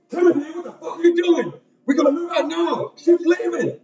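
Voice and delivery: high loud shouting call from outside; urgent yelling. Acted. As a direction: yell to hurry a friend away. Companion yells from outside.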